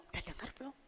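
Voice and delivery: hushed, secretive gossip; gossip whisper. Retold or imitated. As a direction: lean in and murmur the rumour. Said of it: "mereka nggak bilang gini". (bisikan bergosip)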